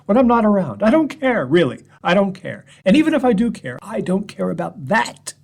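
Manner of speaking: high, loud, rising protest that he doesn't care; frantic denial. Acted. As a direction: fling it out, voice climbing.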